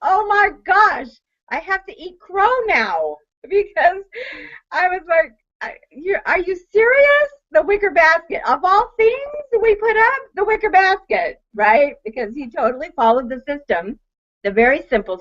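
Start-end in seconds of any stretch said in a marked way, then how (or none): 0.0-11.4 high, incredulous laughing tone; wife amazed it sold. Retold or imitated. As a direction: marvel that the basket sold. Amazed at the sale.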